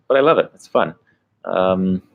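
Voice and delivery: normal talking voice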